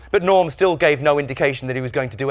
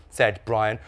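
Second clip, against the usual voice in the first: plain reading voice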